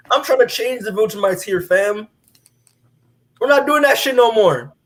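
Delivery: mock stern leader; lightly voiced Nolan, firming up. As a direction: lay down the law to Thrag. Nolan voiced, growing insistent.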